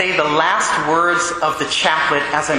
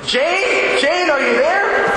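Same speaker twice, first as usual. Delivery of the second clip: high, slow, calling her name out; urgent call; 12 semitones higher, much slower than usual. Retold or imitated. Priest shakes the dying woman's shoulder, calling out high and slow. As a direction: rouse her from far away, drawn out. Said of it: "I should really give this my best effort".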